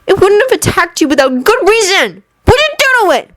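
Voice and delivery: high, rising angry demand; a boy's outrage building. Acted. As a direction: Anakin demanding an answer from the bully. Anakin confronting the bully.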